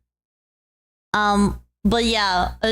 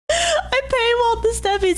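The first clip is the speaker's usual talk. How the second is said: much higher, smug teasing; 12 semitones higher than usual. Acted. Vtuber teasing her fans about the paywall, high and smug.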